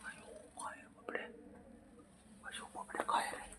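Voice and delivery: soft whispered coaxing. Real, not acted. Owner whispering to tempt the sulking pet out, whisper.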